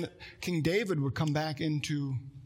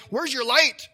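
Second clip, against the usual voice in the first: higher, louder "Where's your light?"; mock alarmed question; 7 semitones higher, 6 dB louder, slower than usual. Churches wondering where the light went, higher and louder. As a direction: cry out in sudden alarm.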